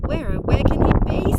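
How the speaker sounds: mild squeal of a worried mother. A narrator's reading. Mother Pig squealing with worry for her lost piglet. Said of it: "squealed Mother".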